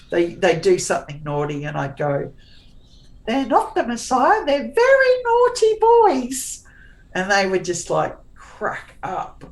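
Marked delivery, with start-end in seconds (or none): 3.3-6.6 high, loud Monty Python shriek, slowing down; Monty Python impression. Mum doing Monty Python to her naughty boys, high shrill shriek. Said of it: "channeling Monty Python".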